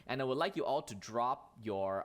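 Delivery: ordinary delivery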